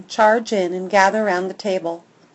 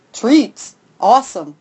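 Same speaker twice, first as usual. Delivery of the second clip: perked-up dude; 5 dB louder than usual. A narrator's reading. Lab perks up.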